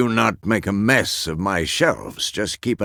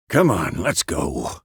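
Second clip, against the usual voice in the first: ordinary delivery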